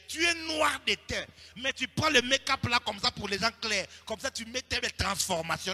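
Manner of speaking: loud, high preaching voice in French and Lingala; fiery sermon delivery; full-force preaching. Acted. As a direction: drive the rebuke home to the congregation.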